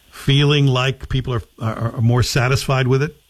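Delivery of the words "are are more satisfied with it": usual speaking voice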